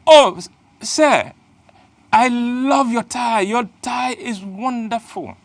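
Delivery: high, slow, sugary flattery; butter up the big man sweetly; flattering young woman. Retold or imitated. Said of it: "and she goes".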